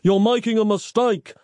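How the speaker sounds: high, mild yell of protest; yelling in vain. A narrator's reading. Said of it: "he yelled".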